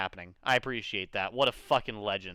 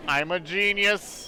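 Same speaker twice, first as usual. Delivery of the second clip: smug; 6 semitones higher, 4 dB louder, much slower than usual. Acted. Reading game line aloud.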